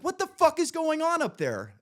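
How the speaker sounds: high, loud demand up at the bunk; angry yell. Retold or imitated. Yelling at the roommate above, angry. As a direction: shout it up at him, fed up. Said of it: "and I'm like".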